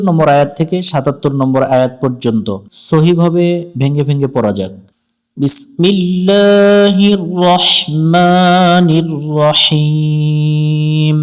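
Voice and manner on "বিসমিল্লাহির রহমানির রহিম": slow melodic tajweed chant; Quran recitation. Acted. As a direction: draw out every vowel in recitation. (ধীর সুরেলা কুরআন তিলাওয়াত)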